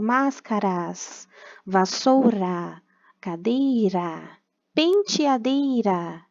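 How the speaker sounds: each word drawn out, R lightly tapped; weak R. Shown as a demonstration. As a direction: slowly model the tapped R in "Máscaras, vassoura, cadeira, penteadeira". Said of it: "Tá vendo que o R é fraco?". (palavras bem devagar, mostrando o R fraco)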